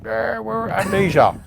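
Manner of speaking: put-on slow, high, slurred drunk voice; mock a wasted club promoter bragging. Mocking a promoter who is off his head, slurring.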